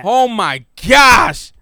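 loud, high, stretched exclamation; stunned shouting. Real, not acted. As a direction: blurt it out slowly, amazed. Still amazed at the knockout.

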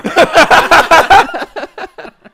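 loud high cackle of a laugh; big laugh burst. Real, not acted.

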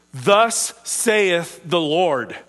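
slow, weighty declaration; prophetic proclamation. Acted. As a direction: draw it out like a prophet's pronouncement. Voicing a prophet addressing the people.

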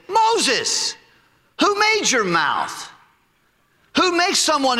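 mock angry God; God rebuking. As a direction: thunder down at Moses, drawn out. God rebukes Moses, preacher voices.

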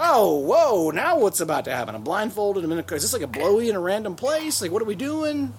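high, loud, giddy imagined reaction; acting excited. Blindfolded partner wondering where the car is going, excited. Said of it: "You'd be really excited".

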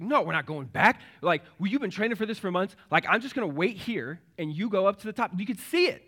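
high, loud, pleading protest; protesting brother. Retold or imitated. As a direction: imitate an upset brother arguing to keep going. Brother protests turning back at summit.

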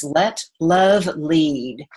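each word slow and deliberate; slow mantra. Shown as a demonstration. Host reciting the show's mantra to listeners, slow and deliberate. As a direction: let it sink in, word by word. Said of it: "the heart dancing mantra is".

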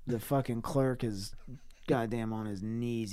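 ordinary delivery

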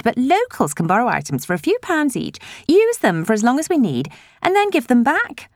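chatty, eager, rising pitch; gushing village busybody. A narrator's reading. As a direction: gush happily about the library. Elaine talks on.

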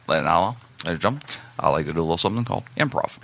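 low, quick comic impression; low, hurried stage patter; Robbie's voice. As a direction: imitate a hopeless comic introducing a bit. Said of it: "he talked like this".

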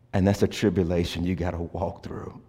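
low and soft, almost hushed; soft refrain. Shown as a demonstration. Pastor murmuring the tribulation refrain to the congregation. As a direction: lay the refrain down gently. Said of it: "repeated refrain, quiet take".

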